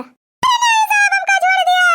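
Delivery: high, slow, drawn-out plea; hammed-up old woman howl; begging wail. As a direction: beg to be let off. Old woman begs not jailed.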